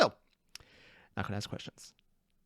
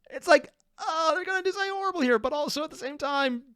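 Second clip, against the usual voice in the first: excited; 12 semitones higher than usual. Real, not acted. Excited dread about the clip.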